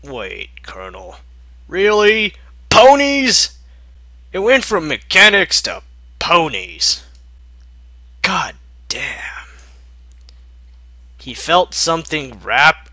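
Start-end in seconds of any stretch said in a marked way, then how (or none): 1.7-7.0 loud, high exasperated disbelief; can't believe it turned into ponies; incredulous reaction. Real, not acted. Reader reacts to story twist. 8.2-9.5 breathy whisper under his breath; whispered curse. Real, not acted. Whispered aside.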